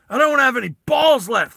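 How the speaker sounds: high, loud hammed-up griping; loud complaining. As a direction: wail it in mock misery. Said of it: "he's, like, complaining".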